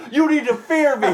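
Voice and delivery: high, shouted threat; mock menacing yell. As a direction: yell it like a tough guy. Driver screaming.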